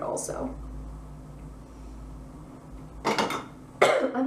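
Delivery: hoarse, fading voice with a cough; losing voice. Real, not acted. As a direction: talk through a voice that is giving out. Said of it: "like, losing my voice".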